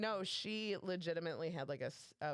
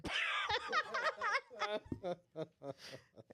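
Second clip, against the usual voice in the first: high, breathless cackle; high laugh. Real, not acted. Storyteller cracks up at the copier bit, laughing. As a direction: burst out laughing.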